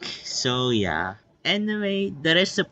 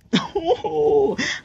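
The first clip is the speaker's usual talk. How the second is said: high giggle. Real, not acted. Laugh at the encirclement.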